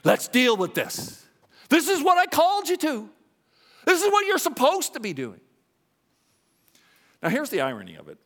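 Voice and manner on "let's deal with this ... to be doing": a hint of a higher, urgent Jesus calling; preached call of Jesus, pushed higher and louder. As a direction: voice Jesus rallying you to fight. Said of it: "believe the truth of Jesus".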